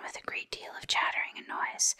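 plain reading voice

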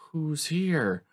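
ordinary talk

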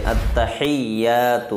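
slow, careful Arabic "اتحیات" with a clear doubled t; teacher modelling "اتحیات"; correct recitation. Shown as a demonstration. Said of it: "تو اتحیات یہ صحیح کریں".

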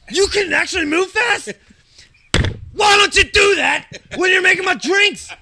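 high, loud mock shout at the bartender; imagined angry rant. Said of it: "this is how I'm gonna start the conversation".

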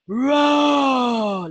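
low, slow roaring; lion roar. Shown as a demonstration. Teacher roars like a lion for the children. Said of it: "how sound does a lion make?".

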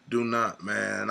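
ordinary talk, nothing marked

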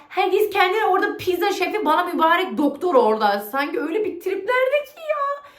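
lightly mocking the coworker, pitched high; a hint of a sneering imitation of his airs.

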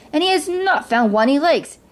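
lightly voiced king airing a complaint; complaining king. A narrator's reading. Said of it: "the king was complaining".

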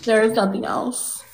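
ordinary talk

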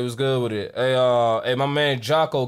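usual speaking voice

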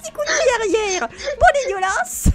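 high-pitched squeal through laughter; gleeful, laughing play-fight commentary; helpless laughing. Real, not acted.

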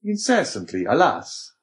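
high, anguished exclamation; crying out. A narrator's reading. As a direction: cry it out with pitch leaping up. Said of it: "cried the Abbé".

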